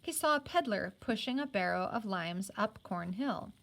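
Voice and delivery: usual speaking voice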